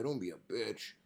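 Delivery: ordinary talk, nothing marked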